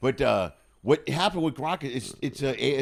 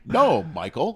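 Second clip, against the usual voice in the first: scolding mom voice; 7 dB louder, slower than usual. Pretend. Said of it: "that tone sounds exactly like her".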